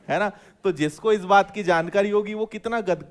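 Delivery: ordinary delivery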